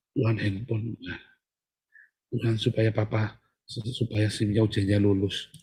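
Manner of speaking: soft, pleading mock prayer of a needy believer; a churchgoer lightly mimicked begging God for things; mock whiny prayer. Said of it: "Terus ya doanya apa?".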